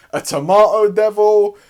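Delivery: ordinary delivery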